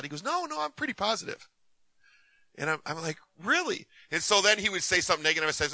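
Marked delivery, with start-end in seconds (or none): 0.2-1.5 high-pitched mock protest. Retold protest to the wife's remark. 3.4-3.9 high-pitched doubting "really?"; sceptical. Retold or imitated. Retold sceptical reply to his claim.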